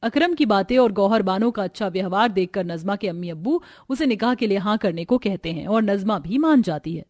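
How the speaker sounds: usual speaking voice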